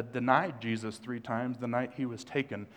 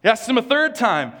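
emphatic preaching; 10 semitones higher, 11 dB louder than usual. Real, not acted. Building the third question.

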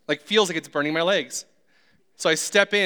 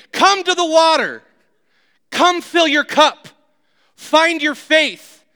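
high, loud, very slow calling out; voice of God; 11 semitones higher, 9 dB louder, slower than usual. Acted. God calling the stuck believer to the water, loud and slow. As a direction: call out grandly, stretching every word. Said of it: "and God's saying".

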